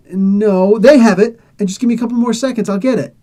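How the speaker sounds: hammed-up villain begging for time; mock frantic pleading. Voicing Red Skull pleading for time.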